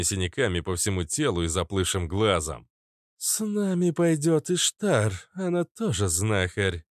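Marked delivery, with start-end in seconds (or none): 3.2-6.8 lightly voiced woman, higher at the start; high opening, easing down; female character. A narrator's reading. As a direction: introduce a companion in a woman's voice. A woman introduces her companion.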